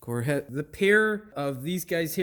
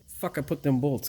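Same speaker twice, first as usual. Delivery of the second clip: ordinary talk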